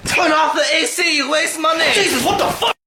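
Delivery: shrill high yell at his son; furious shout. Acted. Comatose father bolts awake, screaming about the AC bill. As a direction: scream at them to turn off the AC.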